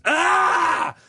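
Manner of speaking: high, loud cry of pain; scream of pain. Retold or imitated. Father heard screaming from the other room, high pained yelp. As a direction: yelp like an old man hurt.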